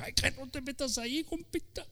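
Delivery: high, soft teasing voice; mocking friend. Preacher mimicking himself warning a friend, high teasing. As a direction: tease like a buddy warning off.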